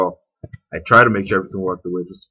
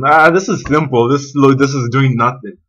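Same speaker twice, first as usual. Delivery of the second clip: dismissive; 4 semitones higher, 6 dB louder than usual. Retold or imitated. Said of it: "You may be thinking".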